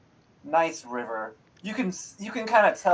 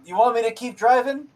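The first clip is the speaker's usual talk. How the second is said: higher, rising question as the driver; driver's remark; 9 semitones higher, 4 dB louder than usual. Acted. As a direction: driver turning round to check with passengers. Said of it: "he kinda remarks, he's like".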